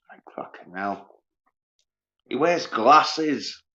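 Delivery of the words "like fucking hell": breathless whispered curse; exasperated whisper; whispered exasperation. Real, not acted. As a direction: whisper it in disbelief. Exasperated aside.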